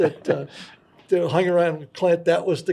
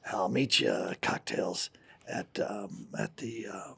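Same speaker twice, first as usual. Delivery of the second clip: hushed Clint quote; 9 semitones lower, 12 dB quieter than usual. Retold or imitated. Clint quietly sets up meeting.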